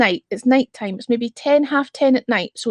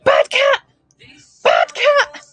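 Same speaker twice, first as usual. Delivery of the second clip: shrieked telling-off, pitched way up; high shouted scolding; 14 semitones higher, 5 dB louder, much slower than usual. Retold or imitated. Cat owner scolding her cat in the garden, shrieked. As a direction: shout it high and sharp at a pet. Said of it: "I'm standing shouting".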